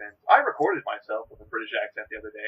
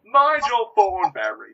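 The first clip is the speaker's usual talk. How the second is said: Nigel Thornberry; 8 semitones higher, 7 dB louder, slower than usual. Pretend. Name said as the character.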